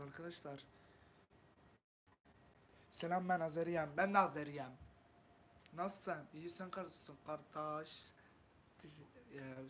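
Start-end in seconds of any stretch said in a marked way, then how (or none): 2.9-8.0 put-on light Azerbaijani accent with open vowels; a touch of Azeri accent. Streamer reads an Azeri viewer's comment in a light Azeri accent. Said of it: "şive yapmıyoruz arkadaşlar".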